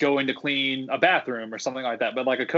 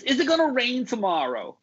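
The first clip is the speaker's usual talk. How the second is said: much higher, put-on guest voice, New Jersey-ish; high mock tourist question; imitated guest voice; 10 semitones higher than usual. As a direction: mimic the pestering guest in a high accented voice. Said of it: "like, in that voice".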